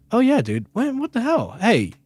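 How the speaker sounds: high-pitched retold voice of an indignant bystander; imitated cashier. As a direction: act out a cashier turning on someone. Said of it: "And the guy goes".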